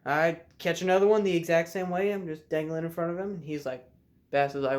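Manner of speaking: normal talking voice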